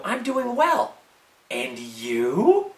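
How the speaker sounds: hammed-up polite reply, each phrase swooping upward at the end; trying to impress. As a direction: overplay the social skills with a big rising finish. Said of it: "really want to impress them".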